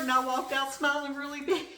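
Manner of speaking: high and loud, laughing through the words; gleeful about her bargain, laughing as she speaks. Real, not acted. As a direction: giggle while telling it. Laughing over the great deal.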